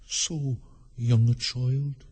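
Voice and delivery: very slow, drawn-out character voice; slow, ponderous gentleman. Acted. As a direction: draw the words out ponderously. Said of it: "said the gentleman sitting opposite".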